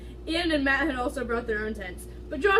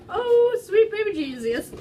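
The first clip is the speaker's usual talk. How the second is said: higher, louder outburst through laughter; shocked laughing exclaim; 9 semitones higher, 8 dB louder, slower than usual. Real, not acted. Reader reacting to the racy line, shocked laughing exclaim. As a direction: gasp it out in disbelief.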